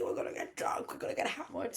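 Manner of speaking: put-on hushed, excited whisper about getting drunk; conspiratorial whisper. Telling a friend the party plan, in a hushed whisper. Plotting a wild night out.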